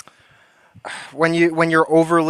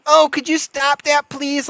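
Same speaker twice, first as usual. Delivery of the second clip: high, fussy mocking voice of a complainer; prissy mock voice; 11 semitones higher, slower than usual. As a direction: mimic a whiny stranger begging politely, pitched up.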